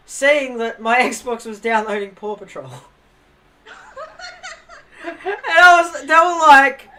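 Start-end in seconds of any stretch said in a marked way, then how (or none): 5.0-6.9 high, loud laughing protest. Retold or imitated. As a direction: laugh through the denial.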